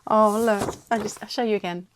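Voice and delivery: normal talking voice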